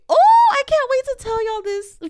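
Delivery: very high, loud eager squeal; excited squeal. Real, not acted. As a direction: burst out with can't-wait glee. Excited announcement.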